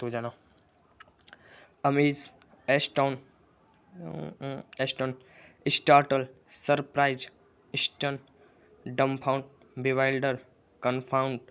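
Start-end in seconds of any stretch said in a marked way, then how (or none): none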